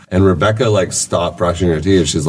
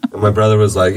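normal talking voice

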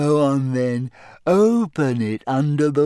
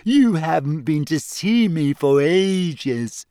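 slow reproachful ghost voice; whiny ghost girl; sulky ghost; 4 semitones higher, slower than usual. A narrator's reading. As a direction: complain like a neglected ghost. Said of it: "she said".

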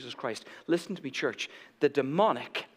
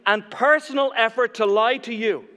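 high, loud, driving sermon delivery; urgent raised preacher's voice; impassioned preaching; 8 semitones higher, 6 dB louder than usual. Real, not acted. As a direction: preach it out to the whole room with force. Preaches at full force.

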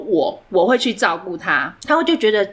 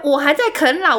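ordinary talk

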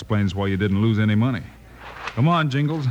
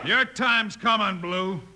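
calling out; 8 semitones higher, slower than usual. Acted. Marshal calls back to outlaw.

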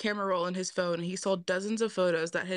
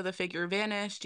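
ordinary delivery

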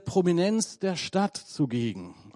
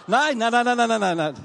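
rapid rising string of nein, louder; laughing off the audience's guess; emphatic no no no; 5 semitones higher, 5 dB louder than usual. Real, not acted. Preacher brushing off the crowd's joke, playful protest.

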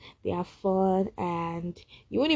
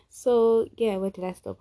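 ordinary delivery

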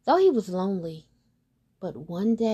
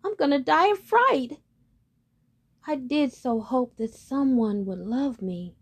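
terrified duckling; 5 semitones higher, 4 dB louder than usual. Acted. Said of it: "moaned the terrified duckling".

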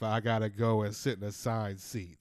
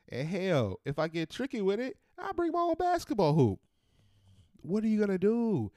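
rising excitement; 10 semitones higher, 3 dB louder than usual. Real, not acted.

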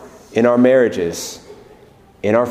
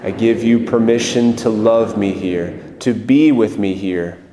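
guided prayer; 3 semitones lower than usual. Acted. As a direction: lead the room gently into prayer.

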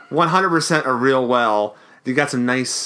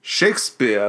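hammy Shakespeare; 3 semitones lower, slower than usual. Shown as a demonstration. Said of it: "I want you to go Shakespeare".

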